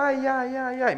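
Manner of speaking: high ai ai, wailing in advance; queen's cries of pain. A narrator's reading. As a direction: squeal out the ouch before it happens. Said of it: "queen anticipates pain".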